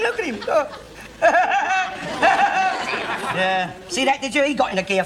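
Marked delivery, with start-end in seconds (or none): 1.0-3.3 high cackling laugh; laughing at his mate; gleeful laugh. Real, not acted. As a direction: laugh hard at him. Laughing at mate's retreat.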